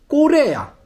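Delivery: "꼬레야" said high, with a tense ㄲ and a clear 야 ending; Georgian pronunciation. Shown as a demonstration. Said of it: "발음 그대로 꼬레야".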